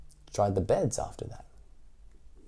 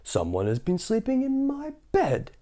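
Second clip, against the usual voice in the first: papa bear voice; 12 semitones higher than usual. Acted. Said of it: "in his great big voice".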